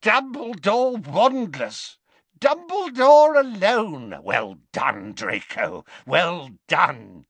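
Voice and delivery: high, shrill-slow cackling glee; gloating witch. Acted. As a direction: crow it out triumphantly. Alecto gloating.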